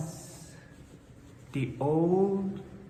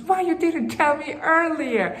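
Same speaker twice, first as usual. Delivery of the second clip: high, louder dismayed question; surprised old woman; 8 semitones higher, 5 dB louder than usual. Acted. Old woman dismayed the children went hungry, surprised. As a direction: burst out in surprise.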